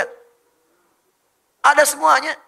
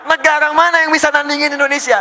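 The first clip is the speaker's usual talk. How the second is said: loud, high rhetorical challenge; belted-out rallying question; rousing shout; 11 semitones higher, 13 dB louder than usual. Real, not acted. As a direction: shout it proudly to a roaring crowd. Rousing challenge over crowd's laughter.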